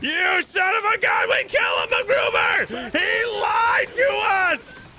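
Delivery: high, slow, shouted fury; hammed-up rage. Captain to lying crewmate, high, drawn-out shouted outrage. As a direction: bellow it like a betrayed captain.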